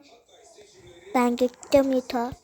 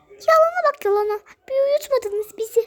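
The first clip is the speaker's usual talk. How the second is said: much higher, fast, scolding; high scolding doll voice; 13 semitones higher than usual. Acted.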